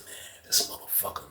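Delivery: whispered retold grumble; muttered aside. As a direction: whisper it under your breath. Aside about Jake, retold.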